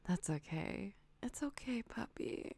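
usual speaking voice

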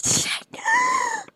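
very high, slow cry out; high climactic shout. Real, not acted. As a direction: shriek it out at the peak. Peak cry.

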